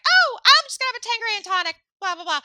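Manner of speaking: high-pitched mock ditzy order; mocking the woman. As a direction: squeak it out, high and quick, like a snooty date. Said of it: "She's like".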